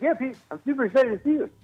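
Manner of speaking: fast, high, breathless host impression; Jimmy Fallon. Host greeting a comedian, excited breathless impression. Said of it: "so Jimmy Fallon was like".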